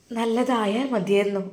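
ordinary delivery

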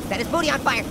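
ordinary talk, nothing marked

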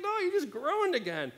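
a hint of a wife catching him out; imitating wife. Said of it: "She's like".

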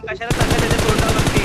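loud, frustrated yell at a teammate; exasperated shout. Real, not acted. Scolding a teammate for rushing the enemy, loud exasperated shout. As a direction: bark it out, why are you running at him.

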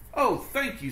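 high, relieved thanks; grateful ladybug. Acted. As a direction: thank them, tired and glad. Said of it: "said the wet, tired".

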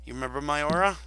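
normal talking voice

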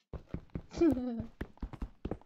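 a whinnying, pony-like laugh; horsey laugh. Real, not acted. Gamer laughing at a tree he found, pony-like whinny laugh. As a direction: laugh like a little horse neighing. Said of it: "I have my weird horsey laugh".

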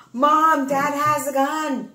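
high, loud, urgent retold phone cry; acted panicked child. Kid phoning Mom about a man in the house, acted panic. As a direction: play a kid raising the alarm, drawn out.

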